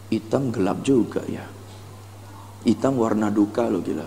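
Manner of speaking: low, soft misgivings; hesitant fussing. Acted. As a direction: mull it over doubtfully, down low. Acted hesitant customer judging colours.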